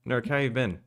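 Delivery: ordinary talk, nothing marked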